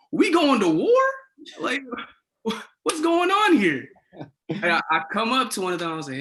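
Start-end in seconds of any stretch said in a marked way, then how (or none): 0.1-3.9 much higher, half-laughing, slower disbelief; incredulous, can't believe the rifles; shocked disbelief. Retold or imitated. RA seeing cops with rifles, high incredulous half-laugh.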